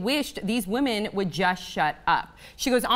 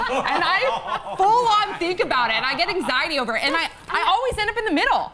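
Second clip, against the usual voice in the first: high, giggly self-mockery; laughing at herself; 8 semitones higher than usual. Real, not acted. Admitting her hair-part anxiety to friends, laughing and high. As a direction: laugh through the confession.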